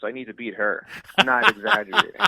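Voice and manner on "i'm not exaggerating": much higher, louder, laughing it out. Real, not acted. Laughing as he tells friend his therapist's marriage lasted seven days. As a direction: insist through real laughter.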